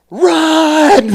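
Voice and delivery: high, loud, drawn-out yell of alarm; panicked yell. Retold or imitated. Drill instructor to recruit about to get beaten, mimicked panicked yell. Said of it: "I hear the panic in his voice".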